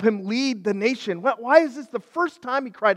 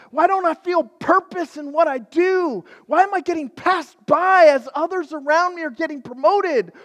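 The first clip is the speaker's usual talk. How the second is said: high, louder pleading cries; anguished questions; 8 semitones higher, 6 dB louder than usual. Retold or imitated. Man waking up alone, asking why in anguish. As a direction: cry out in regret. Imagined future regret.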